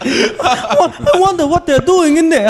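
put-on high voice through laughter; Sidekick impression. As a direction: do a high, hammed-up impression of a comic.

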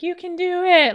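high, loud shout of encouragement; dad calling up to cheer a child on; rousing supportive holler. Retold or imitated. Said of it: "totally cheering me on".

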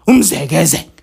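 low answer. Acted. Call and response chant.

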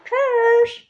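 a high, squeaky toy-llama "Tschüss". Pretend. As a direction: voice the toy llama saying bye. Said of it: "dann sag jetzt nochmal Lama Tschüss".

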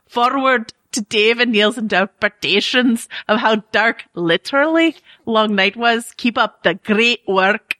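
hammed-up Scottish brogue; badly faked, burry Scottish accent; Scottish accent with heavy burr. Shown as a demonstration. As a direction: lay on lots of Scottish burr to get through it. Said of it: "a lot of burr on it at the end".

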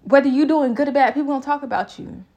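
passing on a friend's blunt reminder; friend's advice. Retold or imitated. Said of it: "she was like".

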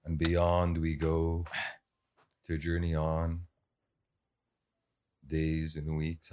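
low, slow trailer voice; slow epic narration. Pretend. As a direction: intone like a movie trailer.

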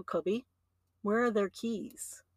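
put-on soft, slow question to a toddler; knowing parent question. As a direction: ask quietly and slowly, already knowing. Parent questioning toddler about hidden keys.

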